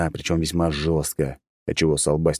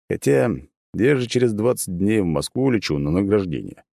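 plain reading voice

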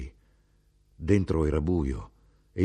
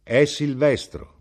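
mother speaking; 9 semitones higher, 8 dB louder, slower than usual. Acted. Mother names her son proudly.

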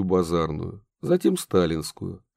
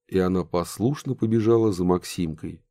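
plain reading voice

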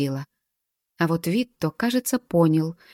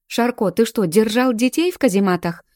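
louder, higher outraged question; scandalised outcry, pitched up; indignant yell; 5 semitones higher, 5 dB louder than usual. A narrator's reading. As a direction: demand an answer in raised, indignant voice. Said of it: "возмущённо завопила я".

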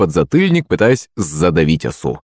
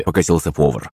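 ordinary delivery, nothing marked

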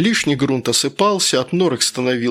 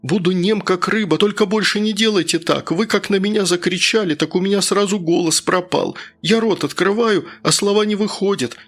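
higher-pitched, gushing plea to the master; a frightened underling begging in a raised voice; pleading worker; 4 semitones higher than usual. Acted. Worker thanks the master for his voice back, higher-pitched pleading.

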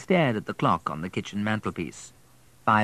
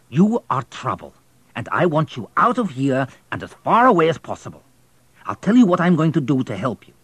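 stern station master; 5 semitones higher, 5 dB louder than usual. Acted. Station master threatening boy.

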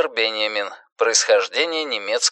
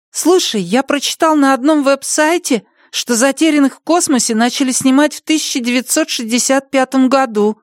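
put-on child voice; 13 semitones higher than usual. Boy tells father.

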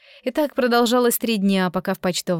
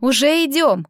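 raised, higher-pitched call; calling back; 5 semitones higher, 4 dB louder than usual. A narrator's reading. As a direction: call an answer to mum indoors. (откликнуться погромче)

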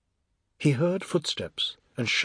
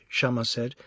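usual speaking voice

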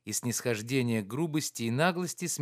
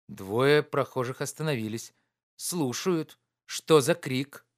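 plain reading voice

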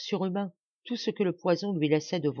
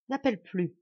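ordinary delivery

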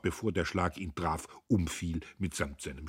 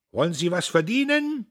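loud, high barker's cry; loud, high huckster's call; bellowing like a barker; 11 semitones higher, 9 dB louder than usual. A narrator's reading. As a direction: shout into the tumult like a fairground crier. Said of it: "brüllte er wie ein Ausrufer".

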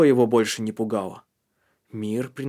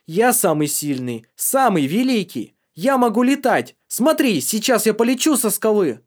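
manic boasting; 9 semitones higher, 6 dB louder than usual. Acted. Drugged boy boasts he can fly.

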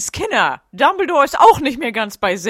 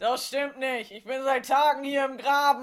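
soft, distant call from a ditch; faint voice from afar; muffled far-off voice; 10 dB quieter than usual. Acted. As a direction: sound stuck down in a hole. Old postman calling from ditch.

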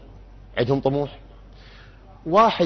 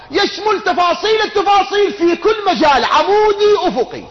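loud, high-pitched proclamation; high insistent crescendo of an absolute claim; emphatic sweeping claim; 5 dB louder than usual. Acted. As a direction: pile it up at full voice, pitched high. Builds up the maximalist claim.

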